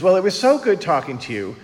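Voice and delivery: booming chatty friend ending the call; imitating Eleanor. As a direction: gush a goodbye and hang up. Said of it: "And then she goes".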